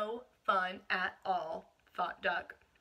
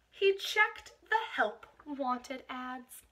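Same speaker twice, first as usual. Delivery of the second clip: usual speaking voice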